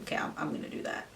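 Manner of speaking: breathy whispered resolve, fast; whispered thought. Retold or imitated. Telling viewers her secret decision in a whisper. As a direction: whisper it to yourself, quick.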